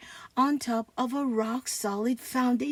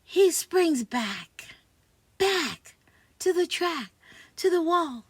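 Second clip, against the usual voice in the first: play-by-play call; 6 semitones higher, 9 dB louder than usual. Pretend. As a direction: call the catch like a live announcer, rising to a roar. Sports play-by-play daydream.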